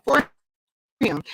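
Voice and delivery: ordinary delivery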